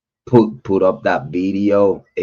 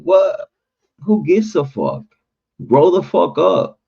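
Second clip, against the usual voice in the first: dismissive retort; 9 semitones higher than usual. Retold or imitated. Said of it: "And I'm like".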